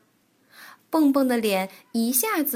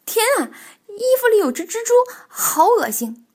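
high, loud, fast cry of disgust; frightened shriek; 11 semitones higher, 7 dB louder than usual. Acted. Bengbeng finds a spider in the costume, frightened shriek. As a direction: shriek in alarm, pitched right up. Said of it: "蹦蹦害怕得直发抖".

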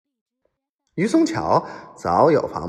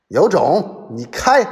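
high, raised-voice dare; defiant shout, pitched up; loud defiant reply; 5 semitones higher, 4 dB louder than usual. A narrator's reading. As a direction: call their bluff at the top of your voice. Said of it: "高声答道".

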